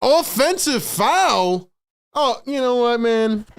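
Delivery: very high, slow, stretched disbelief; incredulous outrage. Real, not acted. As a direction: drag out the question in shock. Incredulous at a foul call.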